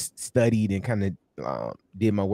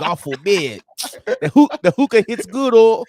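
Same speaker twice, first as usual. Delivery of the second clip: put-on excited Nigerian accent, much higher and louder; 10 semitones higher, 7 dB louder than usual. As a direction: hype the hookah in a Nigerian accent. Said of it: "You do a good Nigerian voice".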